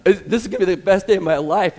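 bursting with doggy glee; excited dog. Pretend. Preacher voicing a dog hoping for dropped food, eager. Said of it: "and dog's like".

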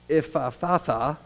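Ephphatha said slowly, syllable by syllable; careful slow Ephphatha. Shown as a demonstration. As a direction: draw out Ephphatha slowly, each sound clear. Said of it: "which is hard to say if you say it fast".

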